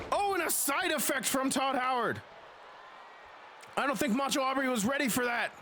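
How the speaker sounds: hyped play-by-play wrestling call; wrestling commentator. Acted. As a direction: call the match like live ringside commentary.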